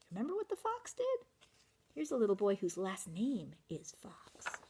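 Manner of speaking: hushed hint to the audience; whispering. Real, not acted. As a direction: whisper it like a secret. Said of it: "aside hinting to listeners".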